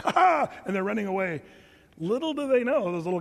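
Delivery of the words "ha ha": loud, high laugh; burst of laughter. Real, not acted. Preacher cracking up at the gazelles story, loud laugh.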